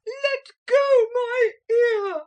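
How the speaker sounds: very high, slow shriek; scream. A narrator's reading. Motor lady to the parrot, screaming. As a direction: howl in pain. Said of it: "screamed the lady".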